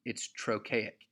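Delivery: ordinary delivery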